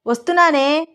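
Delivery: high-pitched answering call; calling back. Acted. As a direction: call back from another room. Mother calls back from the kitchen.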